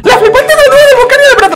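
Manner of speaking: real laugh breaking into a high cry; laughing squeal. Real, not acted. Laughing at Volcán's hug.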